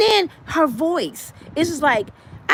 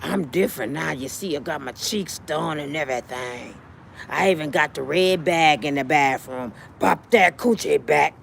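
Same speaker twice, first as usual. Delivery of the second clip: put-on low soft old-lady voice; 11 semitones lower, 4 dB quieter than usual. Vivica flirts with Curtis, low and soft. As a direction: purr it quietly like an aging flirt.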